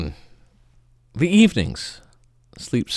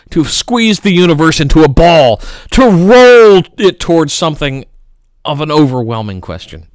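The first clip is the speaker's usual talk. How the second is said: rising declamation; 5 semitones higher, 9 dB louder, faster than usual. Acted. Rising line of recited poem.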